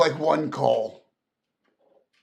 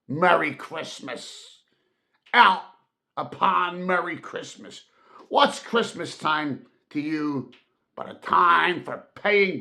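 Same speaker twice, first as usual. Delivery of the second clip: bitter rant; 3 semitones higher, 4 dB louder than usual. Acted. Scrooge ranting at his nephew about Christmas, bitter tirade. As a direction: pour out his grudge against Christmas. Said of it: "returned the uncle".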